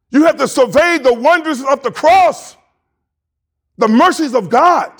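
rising preacher's call; preaching. Acted. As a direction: lift it like a sermon climax. Preacher builds to full force.

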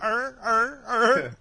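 mock dog grunts. Imitating humping dog.

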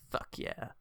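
whispered mock cheer; kid's quiet cheer. Kid reacting to grandpa's pretty girl line, hushed cheer. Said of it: "The kid actually went".

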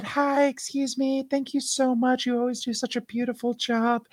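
sugary polite greeting; high-pitched sweet voice. Retold or imitated. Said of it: "When I get my really high-pitched voice and I smile".

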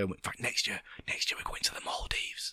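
hushed, breathy secret; whisper. Real, not acted. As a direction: lean in and whisper it. Secret tip whispered to host.

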